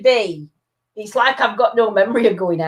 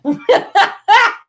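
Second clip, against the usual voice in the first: sudden high squealing laugh; real laugh, pitched up high; high delighted laugh; high burst of laughter; 13 semitones higher, 4 dB louder than usual. Real, not acted. Reacting to Tom Hardy joke.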